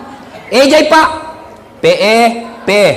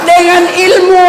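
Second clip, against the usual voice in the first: raised, slow preaching shout; emphatic shout; 9 semitones higher than usual. Real, not acted. Preacher shouting to the congregation about knowledge. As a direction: drive home the word with force.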